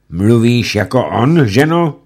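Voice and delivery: high, loud pressing question; pressing judge. Acted. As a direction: demand an answer. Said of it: "ptal se rychtář".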